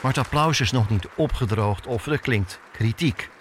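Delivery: ordinary talk, nothing marked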